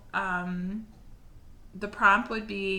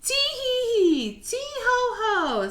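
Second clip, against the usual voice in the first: high, slow sing-song giggle; sing-song leprechaun; 6 dB louder, slower than usual. Acted. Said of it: "sang Liam the Leprechaun".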